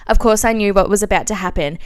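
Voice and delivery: usual speaking voice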